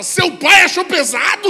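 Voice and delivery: high, loud, slow disbelief; hammed-up shouted astonishment; shocked wife. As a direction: shout it back in mock horror. Wife's stunned reply, acted.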